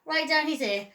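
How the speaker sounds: ordinary delivery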